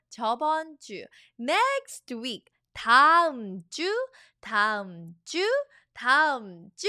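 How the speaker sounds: normal talking voice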